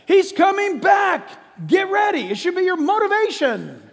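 high, loud, urgent pulpit cry; full-force preaching shout. Real, not acted. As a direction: shout it out to rouse the congregation.

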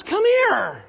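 God calling a sinner in from the porch, eager welcome. Acted. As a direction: wave them in urgently, warm and insistent. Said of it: "God says".

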